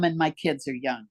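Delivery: usual speaking voice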